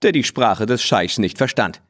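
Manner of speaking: usual speaking voice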